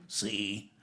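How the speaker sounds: low, deadpan one-word reply; Mel Blanc. Retold or imitated. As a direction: answer low and quiet like the comic in a sketch. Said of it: "And he'd go".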